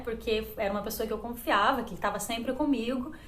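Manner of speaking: ordinary delivery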